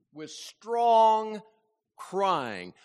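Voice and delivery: "strong" hit hard and loud, "crying" falling after; loud peak on "strong", dropping on "crying"; emphatic stress. Real, not acted. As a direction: lean your whole weight on "strong".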